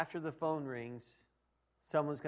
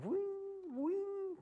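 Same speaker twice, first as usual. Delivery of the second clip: a springing "wing", the second one leaping much higher; imitated dog spring; 4 dB quieter than usual. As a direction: mimic the little dog launching up into the air. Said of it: "and just go".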